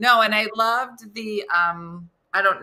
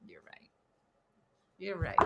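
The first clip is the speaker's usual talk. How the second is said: soft concession; 4 semitones lower, 17 dB quieter than usual. Retold or imitated. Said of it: "And he goes".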